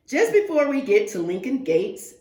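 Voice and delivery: ordinary delivery, nothing marked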